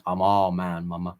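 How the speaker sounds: lightly hammed-up he-man boast; chest-pounding brag. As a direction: play at being a tough guy. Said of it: "pound my chest and I'd be like".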